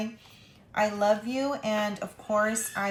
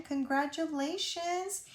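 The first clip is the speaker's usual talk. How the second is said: excited congratulations; 7 semitones higher than usual. Real, not acted. Cheering a friend's news.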